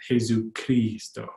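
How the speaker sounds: slow "Jesucristo" with long ee in cris. Shown as a demonstration. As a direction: say "Jesucristo" slowly, J as h, KREE held. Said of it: "the I in Spanish and Tagalog is both E".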